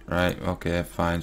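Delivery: normal talking voice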